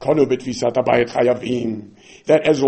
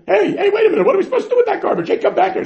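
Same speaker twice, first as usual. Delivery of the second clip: protesting kohen; 3 dB louder, faster than usual. Acted. Kohanim protest getting chometz.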